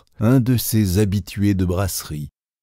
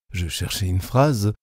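usual speaking voice

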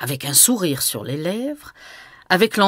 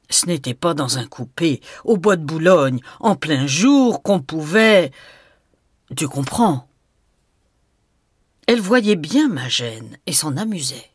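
plain reading voice

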